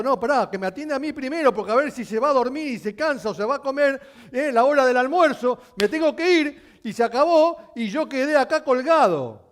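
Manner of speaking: fast, loud and high, pleading to go first; impatient crowd voice. Retold or imitated. A man in the crowd begging Jesus before lunch, fast loud plea. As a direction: rush it out like someone scared to lose their turn. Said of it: "cada uno habrá pensado".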